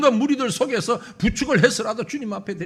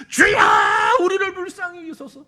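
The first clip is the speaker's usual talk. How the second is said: desperate shout; 7 semitones higher, 8 dB louder than usual. Acted. Blind men shouting over crowd.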